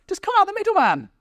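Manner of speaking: very high, insistent urging; squeaky mock know-it-all; hammed-up exasperation. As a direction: push it as the obvious answer, pitched right up. Acted Christ faction recruiting.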